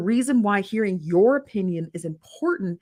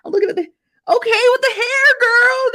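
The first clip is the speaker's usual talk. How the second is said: high, loud, gushing squeal; excited squeal; 9 dB louder than usual. Real, not acted. Host hyping a viewer's big Dallas hair, excited squeal. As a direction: shriek with delight at her hair.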